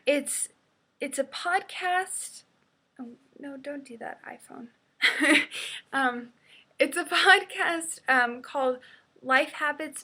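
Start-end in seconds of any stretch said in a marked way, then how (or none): 3.0-4.7 much softer aside to oneself; quiet aside. Real, not acted. Scolding the iPhone mid-recording, quiet aside. Muttered aside to misbehaving phone. 5.0-5.9 loud, quick burst of laughter; short laugh. Real, not acted. Laughing after scolding the iPhone, short burst.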